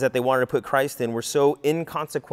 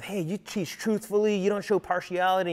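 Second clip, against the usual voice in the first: higher, syrupy mock praise; butter him up in a sugary tone; mocking the flatterers, higher and sweet; mock sweet flattery; 6 semitones higher, 4 dB quieter than usual. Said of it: "came on all sweet and smooth".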